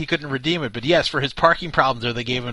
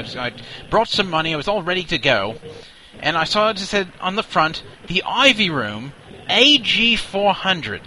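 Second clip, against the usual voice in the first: pompous British colonel, higher and louder; Colonel Nigel Fabersham; 5 semitones higher, 5 dB louder than usual. Acted. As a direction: bluster like an indignant old officer. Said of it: "Colonel Nigel Fabersham here".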